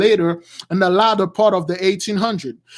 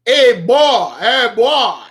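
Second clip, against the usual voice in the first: put-on loud calling out hey boy; imitating a master calling a slave; mocking slave master call; 2 semitones higher, 5 dB louder, much slower than usual. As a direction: bark it like an old overseer. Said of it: "You call them boy".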